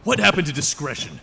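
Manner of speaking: loud, angry dressing-down of the squad; furious outburst. Acted. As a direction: bark at them like a furious boss. Boss confronts agents after botched mission.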